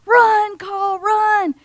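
high, loud shout urging him to run; loud cheer. Acted. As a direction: yell him home from the bleachers. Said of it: "they're saying".

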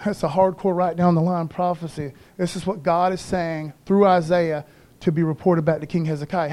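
usual speaking voice

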